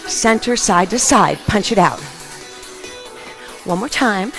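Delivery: normal talking voice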